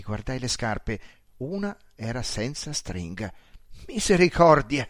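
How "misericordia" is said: louder startled gasp of a word; astonished exclamation. A narrator's reading. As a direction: blurt out in amazement.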